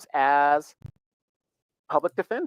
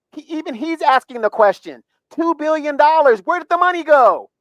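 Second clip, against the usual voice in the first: much higher, louder demanding question; outraged; 10 semitones higher, 6 dB louder than usual. Real, not acted. Demanding to know where the money went, outraged. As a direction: raise it up in disbelief.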